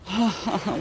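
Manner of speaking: high falling groan of a sigh; exasperated sigh. Real, not acted. After getting hit.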